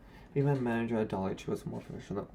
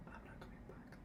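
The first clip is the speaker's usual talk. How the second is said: whispered secret, barely any voice; soft confidential whisper; 21 dB quieter than usual. Retold or imitated. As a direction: lean in and whisper it. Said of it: "and then she whispered".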